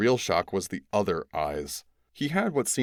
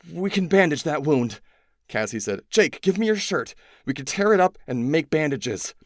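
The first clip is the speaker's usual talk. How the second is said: higher, louder brisk orders; urgent; 8 semitones higher, 5 dB louder than usual. A narrator's reading. Cassie ordering Jake for his shirt, urgent and loud. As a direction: take charge fast, urgent.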